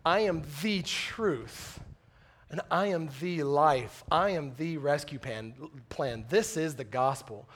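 slow, grave, measured declaration; lightly voiced Jesus, unhurried and weighty; a preacher quoting Jesus with slow gravity; solemn Jesus quote. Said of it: "Jesus says".

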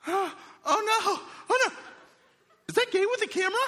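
high, laughing oh no; laughing panic. Retold or imitated. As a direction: mimic her giggly alarm. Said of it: "she was like".

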